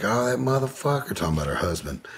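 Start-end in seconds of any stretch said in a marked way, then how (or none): 0.0-1.1 higher, scornful aunt; a hint of a fed-up aunt; aunt's quote. Aunt dismissing her husband, lightly voiced scornful. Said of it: "She was like".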